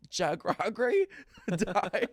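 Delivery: slow, laughing mangled name. Retold or imitated. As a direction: garble the name through laughter. Mock grandson mangling a name.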